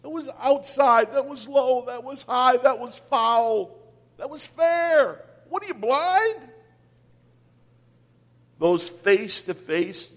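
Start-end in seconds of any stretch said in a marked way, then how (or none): 0.0-5.2 umpire calls. Retold or imitated. Preacher imitating an umpire calling pitches. 5.5-6.4 higher, scoffing jab at the ump; mock heckle. Preacher mimicking a player yelling at the umpire, mock anger.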